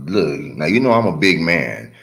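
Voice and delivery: ordinary delivery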